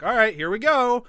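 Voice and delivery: loud, high, mock-eager announcement; game readiness. Imagining her ready with funnel and vial, mock eager. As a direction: gear up brightly for a job.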